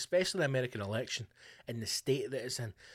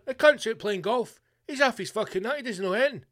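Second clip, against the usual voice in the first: louder and higher, indignant ranting; shouting down the president; mock outraged public; 9 semitones higher, 7 dB louder than usual. People mocking Bush, loud.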